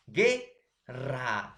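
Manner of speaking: guerra split into two drawn-out beats, gue and rra; syllable by syllable. Shown as a demonstration. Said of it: "ge es una emisión de voz".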